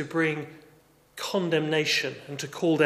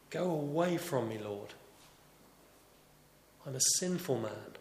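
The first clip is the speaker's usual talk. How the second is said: hushed, softer, as if overwhelmed before his Lord; awed confession; 8 dB quieter than usual. Retold or imitated. Simon Peter, humbled before Jesus, confesses in a hush. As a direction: let it sink to a trembling hush.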